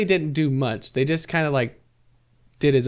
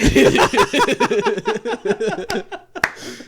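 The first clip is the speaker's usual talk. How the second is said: big laugh; 11 semitones higher than usual. Real, not acted. Laughing at own bit.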